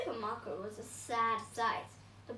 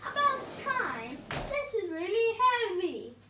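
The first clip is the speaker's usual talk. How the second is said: high, loud grumpy complaint; hammed-up spiteful complaint; spat out; 7 semitones higher, 9 dB louder, slower than usual. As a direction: snap it like an irritated villain. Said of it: "spat the Pea".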